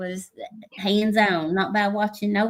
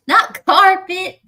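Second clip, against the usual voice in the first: laughing; 10 semitones higher, 7 dB louder, slower than usual. Real, not acted. Laughs at carpet mixup.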